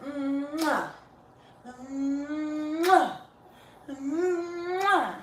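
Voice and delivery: smack kisses at the dog; kissy smooches. Real, not acted. Kissing the dog.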